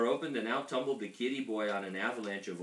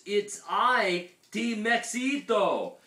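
high, loud, drawn-out proclamation; grand dinosaur announcement; 10 semitones higher, 8 dB louder, much slower than usual. A narrator's reading.

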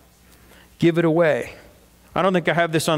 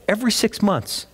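ordinary talk, nothing marked

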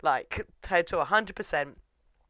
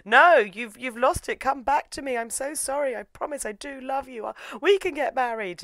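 high, desperate mock plea; pleading Toin; 10 semitones higher, 3 dB louder than usual. Toin begging the delirious Yuki, in a high mock plea. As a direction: beg him to come back, half-hammed.

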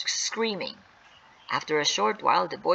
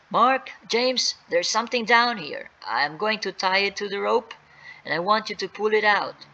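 higher, raised calling voice; 5 semitones higher than usual. Acted. Dad to boys above, calling up from cave. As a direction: call up to helpers with instructions.

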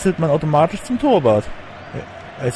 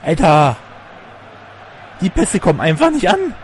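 loud, high frustrated outburst; frustrated shout; 6 semitones higher, 5 dB louder than usual. Real, not acted. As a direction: yell it at the bad passes. Frustrated at failed passes.

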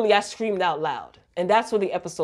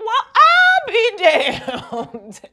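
high, slow put-on voice, laughing through it; drawn-out high exclaim, half laughing; mock old-timey exclamation; 13 semitones higher, 5 dB louder, much slower than usual. Reacting to the show, high slow mock drawl.

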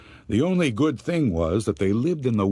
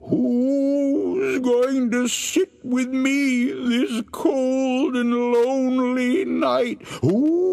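much higher, slower crying ghostly wail; moaning ghost voice; 3 dB louder, much slower than usual. Acted. Ghost drawing closer to the wife, high crying wail. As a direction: drag out every word like a wailing ghost. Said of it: "heard the voice again".

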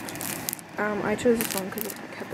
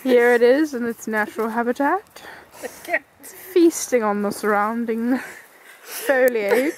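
nature documentary; 2 semitones higher, 7 dB louder than usual. Pretend. Documentary narration of friend.

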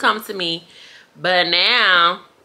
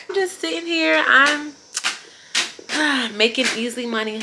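ordinary talk

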